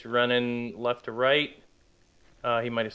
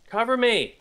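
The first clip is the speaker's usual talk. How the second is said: urgent raised call for cover; cover me shout; 10 semitones higher, 5 dB louder than usual. Real, not acted. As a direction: shout it to your teammate. Urgent call to teammate in firefight.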